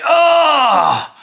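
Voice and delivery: high, loud cry of pain; dwarf cries out, hurting; pained dwarf yell. Acted. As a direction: yelp after a twenty-foot fall. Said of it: "And the dwarf is like".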